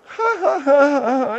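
high, hooting burst of laughter; high cackling laugh. Acted. Host cackling at the Star Trek joke, high hooting laugh. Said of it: "What are you laughing at?".

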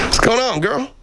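very high cooing to a cat; pet talk. Real, not acted. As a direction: coo sweetly to the cat way up high.

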